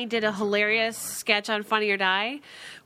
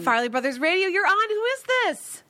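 excited radio host; 9 semitones higher than usual. Real, not acted. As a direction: answer the line like a thrilled DJ. Answers first caller live.

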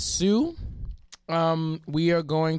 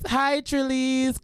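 high, slow, drawn-out greeting; playful fan greeting; 9 semitones higher, slower than usual. Pretend. Host trying a new fan nickname, playful greeting. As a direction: coo it out to the fans.

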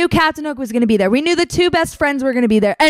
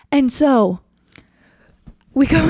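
normal talking voice

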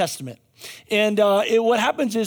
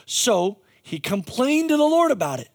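normal talking voice

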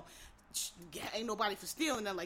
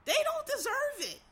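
much higher, outraged squeal of "They don't deserve it"; outraged high repeat; 3 dB louder than usual. Real, not acted. To listeners about forgiving killers, high outraged cry. Repeats line, rising anger.

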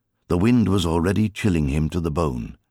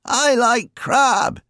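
put-on high, slow grumbling old man; a high, slow, contrary old man's demand; contrary old uncle; 5 dB louder, much slower than usual. A narrator's reading. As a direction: drag it out like a fussy old uncle. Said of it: "he'd say".

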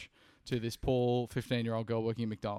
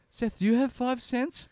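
sheepish plea; 9 semitones higher, 3 dB louder than usual. Retold or imitated. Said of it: "I had to be like".

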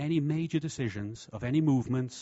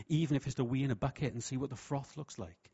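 ordinary delivery

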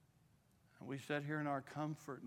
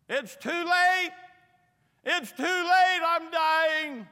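high, loud, slow anguished wail; desperate scream; 14 semitones higher, 19 dB louder, much slower than usual. Retold or imitated. The dying girl screaming from the back bench, anguished wail. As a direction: cry it out as if all hope is gone. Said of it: "she just begins to scream and cry out".

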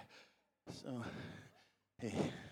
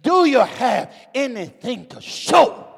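Jesus calling; 8 semitones higher, 8 dB louder, much slower than usual. Retold or imitated. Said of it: "Jesus says".

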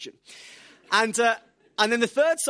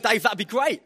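higher and louder, bright eager reply; 4 semitones higher, 8 dB louder than usual. Retold or imitated. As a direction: sound keen and delighted. His own eager reply.

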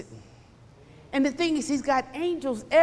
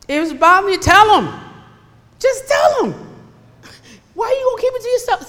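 high, loud, pleading preacher push; urgent preaching; 10 semitones higher, 7 dB louder, faster than usual. Real, not acted. Pastor urging his congregation to tell God, loud pleading. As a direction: urge them hard to speak up.

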